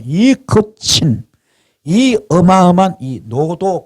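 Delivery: slow, drawn-out words pitched high; high, heavily drawn preacher's emphasis; dramatic awe. Real, not acted. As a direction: stretch each word to convey enormous scale. Dramatizing the raging flood's huge scale.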